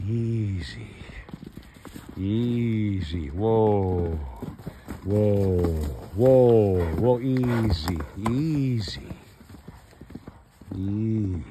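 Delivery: loud, long falling calls to settle a horse; calming horse call. Real, not acted. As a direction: call out to steady a worked-up horse.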